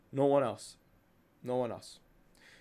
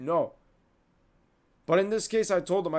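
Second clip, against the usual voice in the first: usual speaking voice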